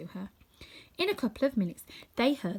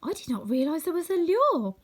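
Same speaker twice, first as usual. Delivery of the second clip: in wonder; 9 semitones higher, 5 dB louder than usual. Acted. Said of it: "responded Dorothy in wonder".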